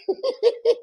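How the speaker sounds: high-pitched giggling; amused giggle. Real, not acted. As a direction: giggle at your own secret. Laughing at her secret.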